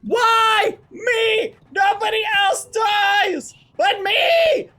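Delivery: loud, high, slow indignant shout; outraged whine. Real, not acted. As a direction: protest loudly at unfairness. Complaining after dying again.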